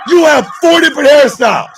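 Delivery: loud jeering jab; loud mocking roast; mocking shout. Real, not acted. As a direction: yell it like a roast punchline.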